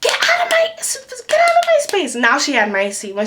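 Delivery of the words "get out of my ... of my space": high-pitched mock screech; mimicked crazed scream, far higher; imitated screaming. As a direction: screech it like a frantic passenger. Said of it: "she was just screaming".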